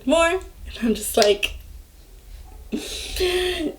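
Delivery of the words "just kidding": high, soft squeal; squealed prank reveal. Retold or imitated. Laughing child reveals the prank to mom, high squeal.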